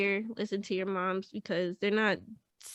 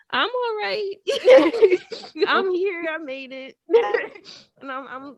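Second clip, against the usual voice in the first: high voice wavering between crying and laughing; tearful laughter; 8 semitones higher, 4 dB louder than usual. Real, not acted. Daughter telling mom she made it, laughing tearfully. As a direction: reassure through tears and giggles. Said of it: "I'm gonna cry".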